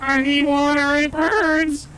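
high, pleading cries for water; begging robot. Pretend. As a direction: plead desperately, slowing down. Car AI begs for water.

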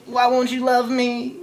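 hammed-up high whine; whiny plea. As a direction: beg like a rejected boyfriend. He whines as she leaves.

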